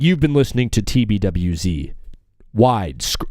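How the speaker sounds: a hint of radio announcer. Shown as a demonstration. Host trying another version of the tagline, low voice-over. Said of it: "It's like".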